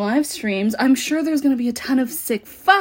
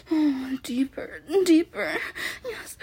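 normal talking voice

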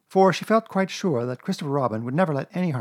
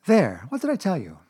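raised I-told-you-so mother voice; chiding Kanga; 6 semitones higher, 5 dB louder than usual. A narrator's reading. Kanga after soap got in Piglet's mouth, chiding. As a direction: chide the child, higher and louder.

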